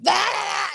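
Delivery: high yell of someone losing it; imitated crazed yell. Mimicking a friend losing his mind at a game, crazed yell. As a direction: blurt out a mock-frantic scream. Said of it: "like, losing your absolute mind".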